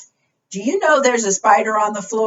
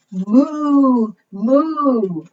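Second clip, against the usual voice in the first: long, higher-pitched moos; cow moo; 5 semitones higher, 3 dB louder than usual. Acted. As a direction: imitate a cow for little ones. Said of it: "said the cow".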